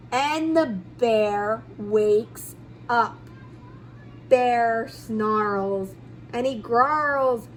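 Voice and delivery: low slow dread; ominous low. A narrator's reading. Bear wakes ominously.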